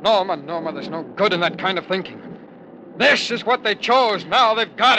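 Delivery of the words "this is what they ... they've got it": higher and louder bitter outburst; bitter angry raised voice. Acted. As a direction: spit out his contempt. Bitter outburst during bombing.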